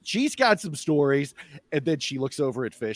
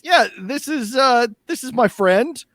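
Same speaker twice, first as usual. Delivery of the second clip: high eager voice; 10 semitones higher, 6 dB louder than usual. Acted. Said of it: "he says".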